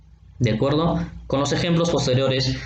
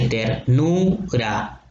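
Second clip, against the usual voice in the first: "ternura" in slow separate syllables, long "nu"; stressed-syllable demo; much slower than usual. Shown as a demonstration. Teacher showing students the accented U, "ternura" split slowly on "nu". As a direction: spell out "ternura" by sound, leaning on the u. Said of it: "La última vocal acentuada es la U".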